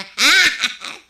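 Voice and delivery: a sudden high, loud cackle of glee; gloating laugh. Acted. Gossip gloating to the councilor's wife, a high, loud cackle. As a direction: laugh out loud, pleased with the plan.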